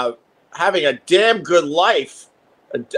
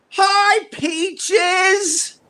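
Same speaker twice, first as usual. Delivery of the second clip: very high, sing-song greeting. Real, not acted. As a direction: coo hello to the plant. Greeting his plant.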